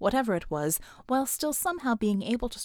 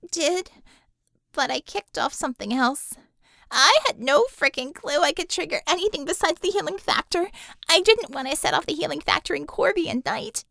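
higher, louder, tense explaining; shaken young woman; 5 semitones higher, 5 dB louder than usual. Acted. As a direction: talk fast through the shock.

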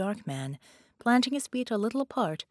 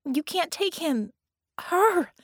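high, urgent, louder hiss; much higher, pressing protest; 10 semitones higher, 4 dB louder, slower than usual. A narrator's reading. Vicky protests to Red that he can't win, high and urgent. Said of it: "Vicky hissed".

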